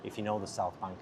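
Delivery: ordinary talk, nothing marked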